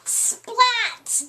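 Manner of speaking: high cheering call of a name. A narrator's reading. Class cheering for the winner, high cheer. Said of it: "they all cheered".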